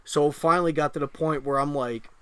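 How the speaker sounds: normal talking voice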